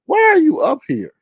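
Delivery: high-pitched incredulous demand; exasperated retort. Retold or imitated. Host asks why the panelist is there, exasperated. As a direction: pitch it way up in mock disbelief.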